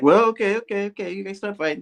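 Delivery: calm the fight down nervously; placating Spidey. Retold or imitated. Spidey tries to break up the argument, high and soothing. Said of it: "He's like".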